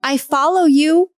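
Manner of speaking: high, emotional appeal; wounded, earnest questioning; hurt body pleading. Retold or imitated. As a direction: plead as the faithful one wronged. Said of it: "my body said back to me".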